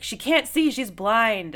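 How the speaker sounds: high, loud urgent alarm; alarmed guard. Retold or imitated. The kind guard alerting everyone that the prisoner is blind, alarmed and loud. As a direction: call it out in a panic for the prisoner. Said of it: "is like".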